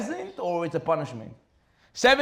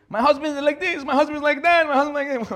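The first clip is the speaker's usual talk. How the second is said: much higher, louder nagging singsong; mock complaining wife; 9 semitones higher, 5 dB louder than usual. A wife complaining about her husband to the judge, mocking. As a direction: mimic a wife listing grievances.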